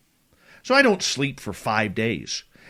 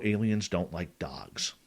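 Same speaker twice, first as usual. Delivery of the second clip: hushed whisper; 6 semitones lower, 6 dB quieter, slower than usual. Retold or imitated. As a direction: whisper it like a secret, slow. Retold secret warning about aliens.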